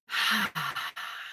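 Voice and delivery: long, quiet exhale; cleansing breath sigh. Real, not acted. Host calming down after venting about mother, cleansing sigh. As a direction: let out a slow calming breath. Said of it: "Cleansing breath".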